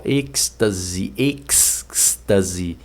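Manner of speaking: "êxtase" drawn out, EKS-ta-zi; slow "êxtase" with a long K-S; pronunciation demo. Shown as a demonstration. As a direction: stretch the X in "êxtase" as KS. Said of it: "é mais ou menos assim".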